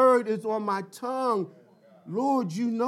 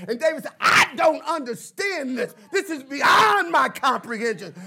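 a pastor building up to a raised, fervent preach; preaching at full force; 4 semitones higher, 4 dB louder than usual. Acted. Pastor to his congregation about David, preaching with growing fire.

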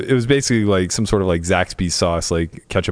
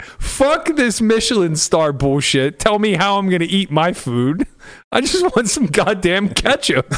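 higher-pitched hammed-up rant about the fancy place; mock fed-up rant; 9 semitones higher, slower than usual. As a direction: vent like a cranky diner, high and slow at first. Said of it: "I was so mad".

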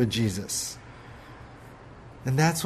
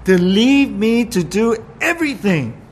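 pitched-up petulant grumble; mock indignant accusation; 7 semitones higher, 4 dB louder than usual. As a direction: accuse her sister in a huff. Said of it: "Martha's saying".

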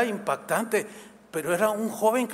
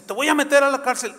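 higher, louder, fast threat; angry threat; 8 semitones higher, 6 dB louder, faster than usual. Retold or imitated. Policewoman threatening the man with jail, heated. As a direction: snap it at him quick.

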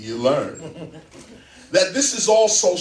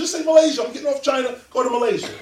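ordinary talk, nothing marked

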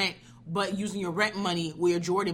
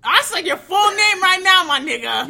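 loud, higher, slowed-down yell; shouting; 11 semitones higher, 12 dB louder than usual. Real, not acted. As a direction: yell it back at him. Shouting back at teasing friend.

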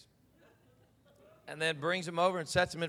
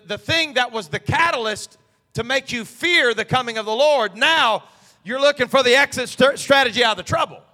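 loud, rising sermon climax; preaching at full force; 7 semitones higher, 15 dB louder than usual. Acted. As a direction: drive it home like a preacher.

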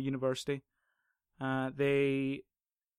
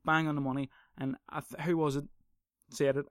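ordinary talk, nothing marked

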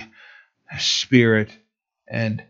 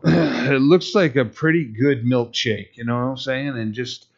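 usual speaking voice

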